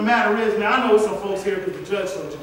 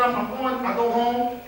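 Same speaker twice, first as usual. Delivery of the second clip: usual speaking voice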